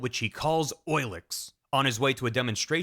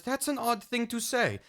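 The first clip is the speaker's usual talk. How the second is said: lightly voiced, higher woman's reply; slightly raised pitch for a puzzled woman; a hint of a female character; Gustava; 8 semitones higher than usual. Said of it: "And Gustava says".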